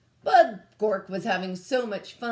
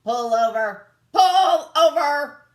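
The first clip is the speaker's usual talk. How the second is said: loud, higher barked command; loud robot order; 7 semitones higher, 12 dB louder than usual. A narrator's reading. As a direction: yell it at the fleeing rover. Robot chasing the alien rover.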